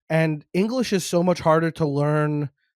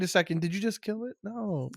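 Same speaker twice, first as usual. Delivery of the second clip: quiet; 3 semitones higher, 9 dB quieter than usual. Retold or imitated. Acted quote, sudden quiet.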